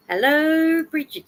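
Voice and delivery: high, loud, bright hello to a new arrival; excited greeting. Real, not acted. Streamer greets Bridget joining the chat, excited. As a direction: sing out hello across the room.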